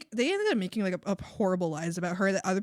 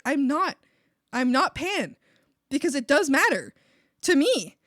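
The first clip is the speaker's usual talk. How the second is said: louder, higher indignant retort; annoyed; 4 semitones higher, 8 dB louder than usual. Retold or imitated. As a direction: push back in annoyance, re-enacting the argument. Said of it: "I got real annoyed at her".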